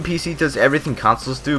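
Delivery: ordinary talk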